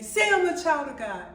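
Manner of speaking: loud, high retold order; acted-out yelling at her son; yelled command. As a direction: order a child forcefully. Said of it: "like I'm yelling at him".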